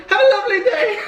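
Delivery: very high, hammed-up New Zealand farewell; mock New Zealand accent. Copying partner's goodbye to the instructor, mock New Zealand accent. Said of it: "tried to match her voice".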